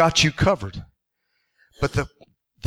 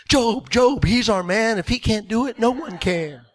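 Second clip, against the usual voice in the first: angel cheerleaders; 5 semitones higher, 3 dB quieter than usual. Pretend. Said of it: "The angels all come in ... with their pom-poms".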